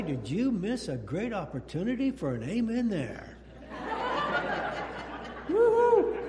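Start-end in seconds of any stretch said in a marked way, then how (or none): 3.6-5.4 laugh. Real, not acted. Laughter after missed amen joke. 5.5-6.2 high, loud delighted whoop; gleeful woo-hoo. Real, not acted. Whoop after amen joke.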